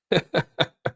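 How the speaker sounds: high laugh. Real, not acted. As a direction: laugh mid sentence. Streamer laughing at chat.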